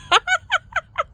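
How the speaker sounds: squealing burst of laughter; high laugh. Real, not acted. Speaker laughing at her own point, high giggle. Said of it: "I'm laughing".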